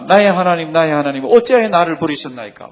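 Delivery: loud retelling of an anguished cry; crying out loudly. Retold or imitated. Preacher quotes Jesus' cry to explain its meaning, loud. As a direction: proclaim the quoted plea loudly. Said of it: "큰 소리로 소리 지르면서 막 그럽니다".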